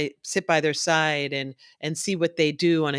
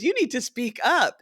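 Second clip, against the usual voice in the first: higher, urging voice; firm friend; 9 semitones higher than usual. Retold or imitated. Friend urging her to ask for help, higher and firm. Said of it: "he says".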